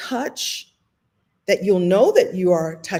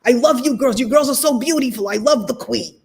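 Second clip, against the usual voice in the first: louder, higher, gushing old-man impression; 5 semitones higher, 4 dB louder than usual. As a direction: imitate an adoring old Brooklyn man.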